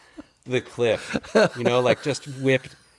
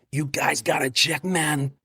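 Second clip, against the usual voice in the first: higher, slower proud big brother; imitating Martin; 7 semitones higher than usual. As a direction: brag about the brother in Martin's voice.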